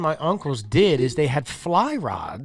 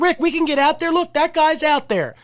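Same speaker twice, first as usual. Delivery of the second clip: eager pleading; 12 semitones higher than usual. Retold or imitated. Telling his cousin Rick the ice is fine, eager high pleading. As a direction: coax him like an eager kid.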